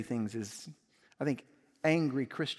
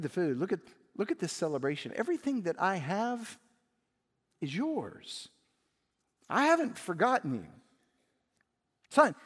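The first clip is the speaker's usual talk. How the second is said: pleading father; 4 semitones higher than usual. Acted. Father pleading with his son.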